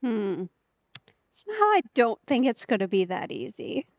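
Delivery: normal talking voice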